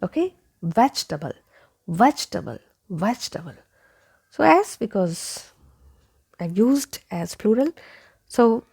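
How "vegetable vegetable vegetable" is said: vegetable as VECH-tuh-bul; three syllables in "vegetable", weak ending; correct pronunciation. Shown as a demonstration. Teacher repeats vegetable for learners, correct pronunciation. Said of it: "so it is vegetable".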